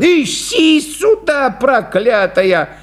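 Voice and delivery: hammed-up frantic clown yell; angry shouting clown. A narrator's reading. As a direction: scold the dog and helper at full pitch. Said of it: "закричал клоун".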